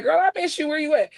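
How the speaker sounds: bright high squeal to a friend, pitch well up; excited greeting. Retold or imitated. Missing her friend after two weeks, high excited greeting. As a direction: greet your best friend like it's been forever. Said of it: "we'd be like".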